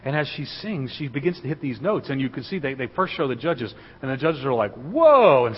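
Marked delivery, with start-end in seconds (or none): 4.7-5.5 high, loud judges' gasp of amazement; amazed whoa. Retold or imitated. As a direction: blurt it out like the stunned judges.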